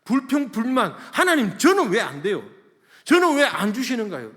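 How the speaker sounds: high, loud protest to God; loud complaining. Retold or imitated. Pastor acting out his old prayers to God, loud complaining. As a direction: cry out a grievance at full voice. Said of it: "주님 앞에 불평불만밖에 안 했어요".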